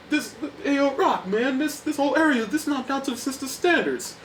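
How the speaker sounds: high, put-on Paulie voice; whiny complaining drunk; Paulie impression. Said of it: "he's like".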